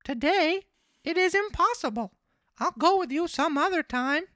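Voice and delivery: very high, loud puppet voice; marionette voice. Acted. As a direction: pitch it way up as the naive little marionette. Pinocchio puts off the fox.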